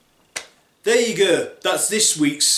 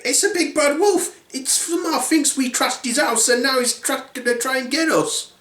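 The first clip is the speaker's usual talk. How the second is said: high squeaky piglet voice, breathless worry; frightened little pig; 11 semitones higher than usual. Acted. Little pig warning his brother about the wolf, high and anxious.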